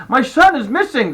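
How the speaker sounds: panicked father, high and loud; out of breath. A narrator's reading. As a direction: blurt the alarm urgently. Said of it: "He sounded out of breath".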